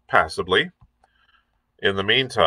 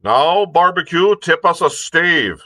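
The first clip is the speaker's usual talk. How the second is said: much higher, louder sailor's call; crying out; 9 semitones higher, 4 dB louder than usual. A narrator's reading. A sailor shouts to Barbecue for a song. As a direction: shout a request across the deck. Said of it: "cried one voice".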